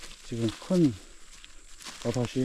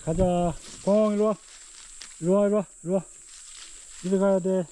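much higher, eager dog-calling; talking to a dog; 6 semitones higher than usual. Real, not acted. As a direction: call the dog along brightly.

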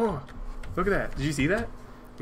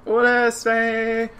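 put-on Spanish accent; 7 semitones higher, 6 dB louder, much slower than usual. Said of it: "Can you do a Spanish accent?".

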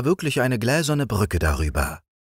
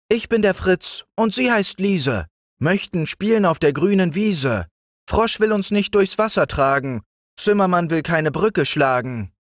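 hoch und zügig wie ein kleiner Junge; Jungenstimme; 11 semitones higher than usual. A narrator's reading. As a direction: eifrig erklären wie ein Kind. (Brüderlein erklärt dem Zimmermann schnell und hoch)

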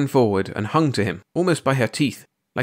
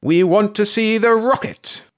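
put-on high, raised old lady's protest; insistent demand; 11 semitones higher, 4 dB louder than usual. A narrator's reading. Old lady demands, forging ahead.

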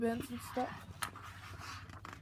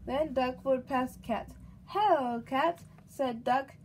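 lively, louder storybook read-aloud; reading to a child; 3 semitones higher, 5 dB louder than usual. A narrator's reading. Mother reading a picture book to her baby, lively storybook voice. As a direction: read it out brightly for the little one.

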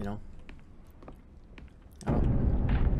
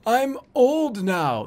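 put-on higher, slow, airy old-man parody; mock breathy old man; 7 semitones higher, 4 dB louder, much slower than usual. Shown as a demonstration. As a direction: add air to sound old, mockingly. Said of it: "just making your normal voice have little more air".

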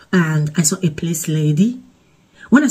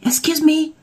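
police lady; 10 semitones higher than usual. Retold or imitated. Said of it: "She was like".

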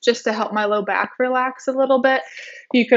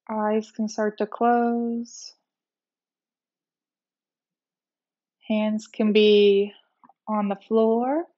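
guided meditation; 4 dB quieter than usual. Real, not acted. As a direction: ease them gently into rest, unhurried. Leads a guided relaxation meditation.

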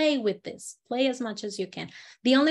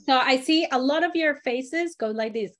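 ordinary delivery

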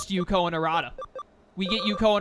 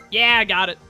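excited; 9 dB louder than usual. Real, not acted. Cheering at answer choice.

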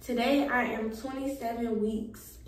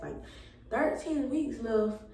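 ordinary delivery

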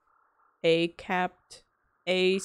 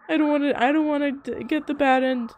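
much higher, louder begging; desperate pleading; 8 semitones higher, 11 dB louder than usual. Real, not acted. Player begs Ezra not to get the bad ending, high and loud. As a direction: beg loudly in a high panic.

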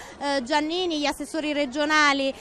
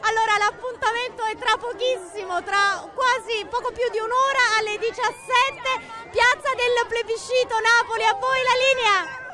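raised, higher-pitched voice over the crowd; excited live report; 6 semitones higher than usual. Real, not acted. Reporter calls out over excited children's chorus. (voce alta ed entusiasta)

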